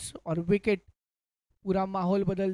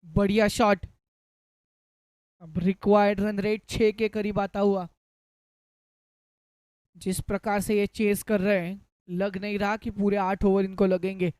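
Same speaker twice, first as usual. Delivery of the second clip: hindi cricket commentary. Real, not acted. Live cricket play-by-play.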